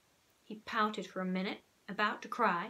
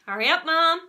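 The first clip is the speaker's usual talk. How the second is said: louder urgent call; raised urgent call; 7 semitones higher, 11 dB louder than usual. Acted. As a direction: hurry Mom from down the hall. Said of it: "I called".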